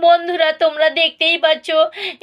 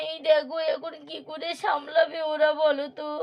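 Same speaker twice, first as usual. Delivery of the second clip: much softer murmured chat at a funeral; hushed gossip; 12 dB quieter than usual. Acted. Neighbours gossip in hushed tones. (শোকবাড়িতে চাপা গলায় কথা)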